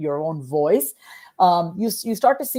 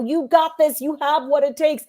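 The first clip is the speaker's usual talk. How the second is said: higher, louder coach cheering you on; motivational coach; 8 semitones higher, 4 dB louder than usual. Shown as a demonstration. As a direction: pump up your athlete before the game. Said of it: "channel that coach in us".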